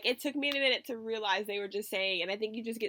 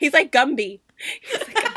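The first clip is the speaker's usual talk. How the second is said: real helpless laughter, loud and higher; laughing while talking; 5 semitones higher, 11 dB louder than usual. Real, not acted. Host compares skinny singer to a cartoon, laughing hard. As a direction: barely get the joke out for laughing. Cracking up over own comparison.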